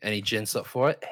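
cheeky mock English pub lad; English lad accent. Lad asking gents along to the loo, English accent. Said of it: "you also have this English accent".

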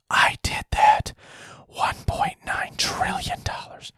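slow, exaggerated stage whisper; drawn-out mock whisper. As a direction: imitate a speaker whispering slowly into a mic. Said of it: "whispering in the microphone".

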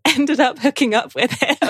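giggling through an embarrassed confession; a cheeky confession to the hosts, giggling; laughing. Real, not acted. Confessing a fling on a plane.

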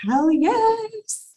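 high, loud whoop of joy; excited cheer. Real, not acted. Host cheering a client's doubled contract, loud whoop. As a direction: cheer her on loudly.

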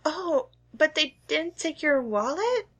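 high, gentle shop clerk; sweet small-town cashier; shopkeeper voice. Acted. GM voicing Shirley.

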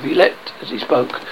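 usual speaking voice

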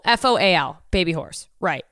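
normal talking voice

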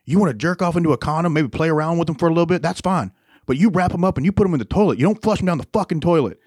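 exasperated dad impression, pitched high; high, insistent retold lecture; dad trying to relate. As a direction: imitate a dad reasoning, then losing patience. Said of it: "he was trying to relate".

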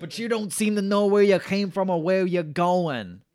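put-on loud, high Bronx accent through laughter; hammed-up Bronx brother scolding his sister; Tony. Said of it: "he's totally from, like, the Bronx".

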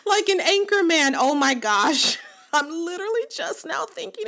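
high laughing giggle. Real, not acted. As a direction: crack up mid-sentence. Laughing at own joke.

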